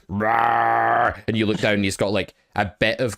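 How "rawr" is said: mock dog growl. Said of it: "he's like".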